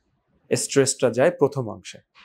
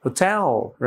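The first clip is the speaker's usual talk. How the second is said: "Hotel" with "টেল" stressed and falling; second-syllable stress; 3 semitones higher, much slower than usual. Shown as a demonstration. Teacher repeats the exception after asking learners, second-syllable stress. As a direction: slide quickly over "হো", stretch "টেল". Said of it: "the second syllable".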